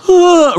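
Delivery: high-pitched "ugh" of disgust; acted-out yelp of disgust; mock disgusted groan. Man finds it on his shoe.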